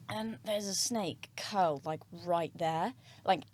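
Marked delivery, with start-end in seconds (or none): 3.2-3.4 laughing. Real, not acted. Nervous laugh recalling snake.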